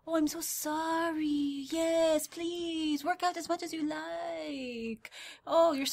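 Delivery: put-on high, very slow apologetic Dracula accent; hammed-up old lady with Dracula accent. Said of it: "Okay, now that's Dracula".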